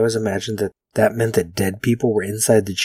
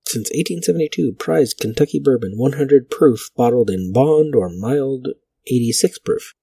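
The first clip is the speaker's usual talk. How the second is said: louder vintage announcer read; 3 semitones higher, 3 dB louder than usual. Acted. Vlogger reads an old whiskey ad aloud in announcer voice. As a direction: sell it like a fifties radio ad.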